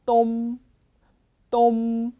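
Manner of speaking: 똠 held on a closing m; pronunciation demo. Shown as a demonstration. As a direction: model 똠 alone, lips shut on the final m. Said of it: "예를 읽어볼게요".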